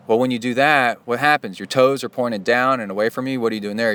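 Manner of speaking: ordinary talk, nothing marked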